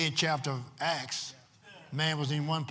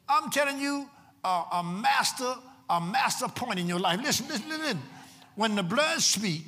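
raised pitch, urgent preacher's delivery; excited preaching; 8 semitones higher, 4 dB louder than usual. Real, not acted. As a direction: press the congregation to listen.

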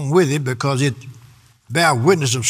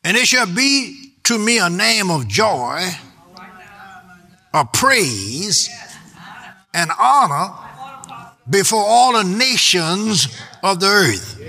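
scripture reading; 4 semitones higher, slower than usual. Acted. Reads scripture aloud slowly.

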